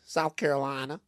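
lightly imitated Southern lady; a soft Southern lilt; Southern accent. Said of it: "They have very thick Southern accents".

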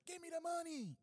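high, loud mock robber demand; mock robber yell. Mocking the robber shouting at the teller, high and loud. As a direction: yell it like a movie stickup.

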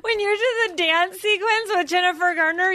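high, eager reminiscing; mock over-excited fan. Fan reminding the actor of a dance scene, over-excited. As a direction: gush like a fan recalling a scene. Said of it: "everyone turns into Chris Farley".

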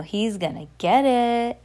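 slow, stretched "get it"; drawn-out sibling taunt; gloating warning. Shown as a demonstration. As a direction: taunt that someone's in for it. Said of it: "But no".

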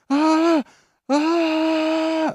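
mock scream of a guy on salvia. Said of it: "just starts screaming".

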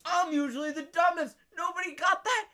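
high, retold boast; gloating. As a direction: crow proudly, imitating the kid. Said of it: "he was gloating".

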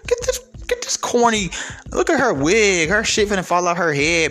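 high-pitched mocking imitation, easing down; women viewers' reaction. Women viewers mocking Cynthia G's wig, high imitated scorn. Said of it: "listen to Cynthia G and be like".

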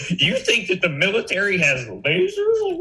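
high, skeptical Karen voice. Retold or imitated. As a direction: sneer it like a suburban Karen. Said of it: "some Karen's like".

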